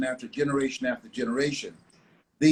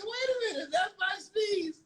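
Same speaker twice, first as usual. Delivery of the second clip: laughing disbelief; 5 dB quieter than usual. Retold or imitated. Man retelling fleeing his own sneeze, laughing high. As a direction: squeal it out through laughter, amazed at himself.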